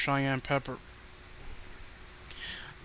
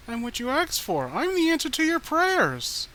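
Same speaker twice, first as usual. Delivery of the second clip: much higher, louder cooing; eerie sweet voice; 11 semitones higher, 5 dB louder than usual. Acted. Danielle telling Danny she's his answered prayer, creepy cooing. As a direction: croon it like a doll come to life.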